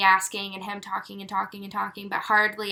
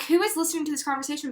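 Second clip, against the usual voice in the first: quick panicky aside; 5 semitones higher, 3 dB quieter, much faster than usual. Retold or imitated. Embarrassed panic in public.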